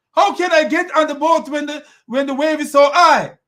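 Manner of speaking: put-on loud, high protesting boy; boy's voice. Boy questioning his father's order, high loud mock voice. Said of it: "the boy's reaction is not".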